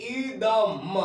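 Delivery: इदम drawn out slowly, each sound held; slow demo reading. Shown as a demonstration. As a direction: read इदम slowly for the class. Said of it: "अब इसको पढ़ेंगे".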